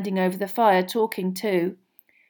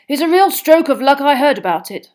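Mr Diggory; 6 semitones higher, 11 dB louder than usual. A narrator's reading. Diggory voiced.

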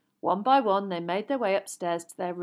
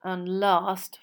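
ordinary delivery

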